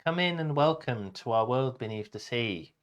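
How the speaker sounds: ordinary delivery, nothing marked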